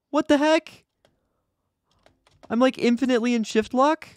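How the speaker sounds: very high-pitched disbelief; baffled, voice shot way up high; bewildered shock. Real, not acted. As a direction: squeal the questions in alarmed confusion. Startled by game's shift lock glitch.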